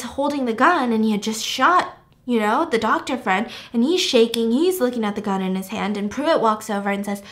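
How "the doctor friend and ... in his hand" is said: normal talking voice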